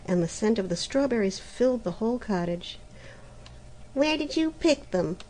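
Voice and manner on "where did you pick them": a sulky, raised demand. A narrator's reading. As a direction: sound put out and grudging. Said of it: "asked Helena sulkily".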